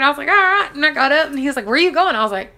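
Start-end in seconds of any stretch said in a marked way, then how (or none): 0.3-0.7 high, loud and eager; eager all right. Retold or imitated. Answering the doctor after the easy birth, eager and loud. 1.7-2.2 higher, louder startled question; a doctor caught off guard; surprised doctor. Retold or imitated. Doctor sees the new mother already up, surprised.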